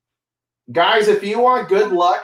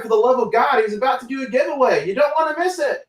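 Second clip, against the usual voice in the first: high-pitched, rising call to viewers; urgent hype plea; 7 semitones higher than usual. Real, not acted. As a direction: plead with the audience, climbing higher to the end.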